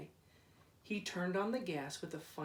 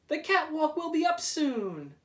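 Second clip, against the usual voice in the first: higher, loud happy call; 10 semitones higher, 7 dB louder than usual. Acted. Workmen announcing progress across the river, loud call. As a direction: shout the news across the water. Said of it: "they called".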